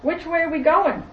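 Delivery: put-on high, loud little-girl question; lightly voiced child asking the captain. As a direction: pitch it up like a curious little girl. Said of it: "the little girl says to the captain".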